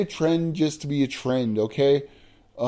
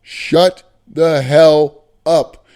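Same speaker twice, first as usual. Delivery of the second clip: loud slow retort; 9 dB louder, much slower than usual. Real, not acted. Angry rant at complainers.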